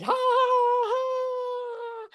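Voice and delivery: high whooping ha ha into the wind; whooping laugh. Shown as a demonstration. Teacher acting a motorcycle ride, high whooping laugh.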